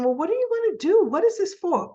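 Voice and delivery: high-pitched, quick retold questioning; mock demanding questions. Soap heroine asks what he wants to do, mock demanding. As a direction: imitate her pressing him, voice jumping up. Voicing her questioning of Victor.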